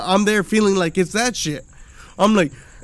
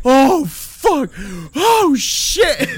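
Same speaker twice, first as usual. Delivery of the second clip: high, louder frightened cry; panicked yelp; 7 semitones higher, 5 dB louder, much slower than usual. Retold or imitated. On the kids' ride going up, high panicked yelp. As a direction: squeal in panic at the top. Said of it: "I'm like".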